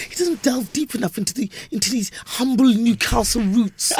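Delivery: high-pitched lofty critic; mock pompous reviewer. Imagined critic at Ross Noble's show, high and pompous. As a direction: draw it out like a snooty review. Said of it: "go to Ross Noble's show and go".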